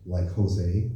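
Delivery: "Jose" said "Hosay" with an H; Spanish J as H. Shown as a demonstration. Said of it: "the J makes an H sound".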